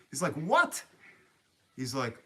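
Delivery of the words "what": loud, very high rising yelp; incredulous. Retold or imitated. Kid hearing he may fetch the drone twice, incredulous. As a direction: imitate a kid's shocked disbelief. Kid's disbelief at second flight.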